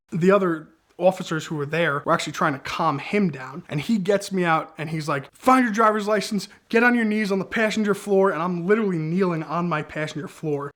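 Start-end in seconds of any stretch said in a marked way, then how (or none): 5.3-8.3 cop barking orders. Retold or imitated.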